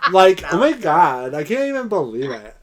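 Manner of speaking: hammed-up Valley Girl voice; exaggerated Valley Girl disbelief. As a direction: gush it like a mock Valley Girl. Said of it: "There's a Valley Girl voice".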